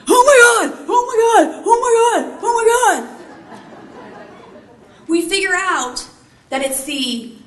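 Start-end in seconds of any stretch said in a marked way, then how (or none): 0.1-3.2 high panicked shouts, much louder; panicking. Retold or imitated. Pilot in command panicking over a fluctuating engine gauge, shouting in panic. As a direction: shriek it like a pilot losing his nerve. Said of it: "The pilot in command starts panicking".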